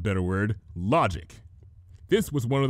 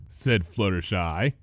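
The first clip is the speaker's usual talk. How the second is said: ordinary delivery, nothing marked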